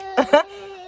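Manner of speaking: sudden high squealing laugh; a real laugh bursting out high; laughing at her own joke about height; high burst of laughter. Real, not acted.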